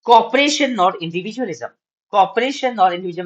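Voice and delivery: heading called out high and slow; proclaiming the heading to the class; emphatic heading read. Acted. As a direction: announce the principle with a lift.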